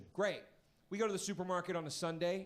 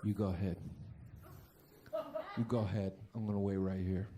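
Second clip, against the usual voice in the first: lower, menacing calm; Goodfellas tough guy; 8 semitones lower, faster than usual. Retold or imitated. Comic quoting Ray Liotta to his parents, lower menacing calm. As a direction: play it like a mobster before a beating. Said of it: "Goodfellas move".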